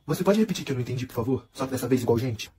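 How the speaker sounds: low, soft, mocking calm; sarcastic low retort. Davi mocking Giovana, low and soft. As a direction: sneer it back quietly. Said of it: "E ele falou assim".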